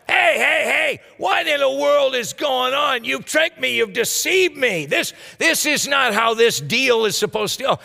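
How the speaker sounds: high, panicked shouting witch; witch freaking out. Acted. Witch panicking at Samuel showing up, high frightened shout. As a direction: cry out in alarm as the spell goes wrong. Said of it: "she starts freaking out".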